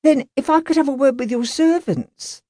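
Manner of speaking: lightly voiced, dogged policeman; a hint of a humble London constable; polite constable. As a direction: press the question politely. Constable questioning Grant.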